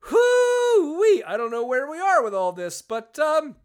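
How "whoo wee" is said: very high, loud whoop; exclaimed whoo-wee. Real, not acted. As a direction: let out a big high whoop. Exclaims, lost for an ending.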